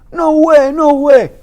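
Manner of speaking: loud, high, desperate cry; shouting in denial. Retold or imitated. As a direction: yell it like you refuse to believe it. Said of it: "I'm saying".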